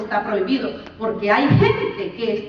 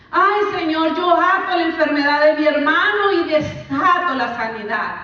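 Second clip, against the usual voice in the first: loud, higher mock "Ay, Señor" plea; mocking prayer; 5 semitones higher, 5 dB louder than usual. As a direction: parody the rebuking prayer again. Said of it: "Muchas veces lo usamos así". (imitación burlona de oración)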